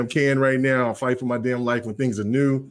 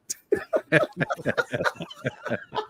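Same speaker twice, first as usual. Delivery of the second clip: high laugh; 6 dB quieter than usual. Real, not acted. Big laugh after greeting.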